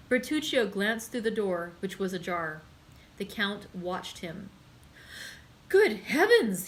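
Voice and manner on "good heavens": shocked cry of alarm, high and loud; exclaimed. A narrator's reading. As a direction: blurt it out in startled horror. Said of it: "he exclaimed".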